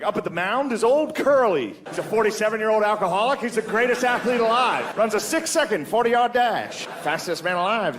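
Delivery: high, excitable vintage play-by-play; old-time radio announcer. Acted. Announcer introducing Old Curly, high vintage radio voice. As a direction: hype the player like an old radio caller. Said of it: "You can hear the announcers".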